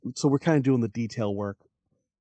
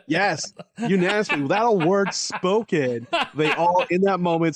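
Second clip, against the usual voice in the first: laughing; 5 semitones higher, 4 dB louder than usual. Real, not acted. Laughing while telling.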